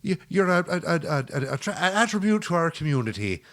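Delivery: high, loud, jaunty Irish patter. Acted. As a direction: burst out in a hammed-up Irish accent, stammering through the praise.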